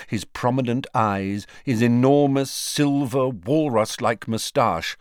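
usual speaking voice

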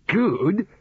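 high, disbelieving echo; incredulous one-word question. A narrator's reading. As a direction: throw the word back high, rising.

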